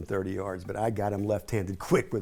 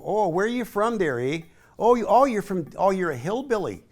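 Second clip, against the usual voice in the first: higher, sing-song mock Fargo accent; 7 semitones higher than usual. Saint Louis kids quizzing the new hillbilly, mock Fargo accent. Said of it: "they sound like they're from Fargo".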